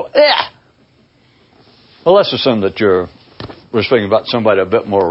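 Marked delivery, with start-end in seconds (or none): 0.0-0.5 very high yelp of distaste; hammed-up squawk of dismay; mock disgusted noise. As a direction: recoil with a short, high "ugh". Imitates glance back at the article.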